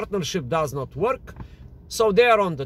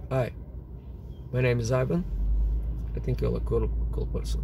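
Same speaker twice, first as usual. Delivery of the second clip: quiet, lower, unhurried pickup line; low energy; 6 semitones lower, 6 dB quieter, faster than usual. Shown as a demonstration. Teacher demos a pickup line, low energy and quiet. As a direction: say it softly, no push behind it. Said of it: "That is low energy".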